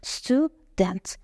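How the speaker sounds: استودنت slowly as stu, dent; correct two-syllable split. Shown as a demonstration. Teacher showing it starts with s, correct split. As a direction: start استودنت straight on s, two separated beats.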